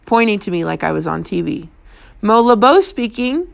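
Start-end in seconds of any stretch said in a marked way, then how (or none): 2.2-3.5 higher pitched, brittle phone greeting; a touch of brittle poise; voice like glass. A narrator's reading. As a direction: answer with a voice like glass. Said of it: "my voice like glass".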